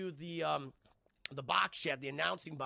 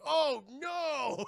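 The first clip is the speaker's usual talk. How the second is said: high, loud "oh no" with laughter; laughing hammed-up alarm; mock dread; 9 semitones higher, 5 dB louder than usual. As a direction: wail in comic dismay, cracking up. Godzilla's look of dread, laughing.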